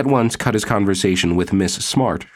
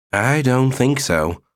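slow, calm and firm refusal; level but firm; 6 semitones higher, slower than usual. A narrator's reading. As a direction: say it evenly but do not budge. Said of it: "in a level but firm voice".

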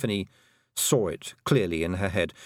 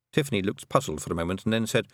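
ordinary delivery, nothing marked

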